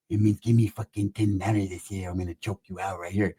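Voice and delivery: low threat, close in his face; menacing Pepe. Retold or imitated. Pepe threatening to choke Roach out, low and menacing.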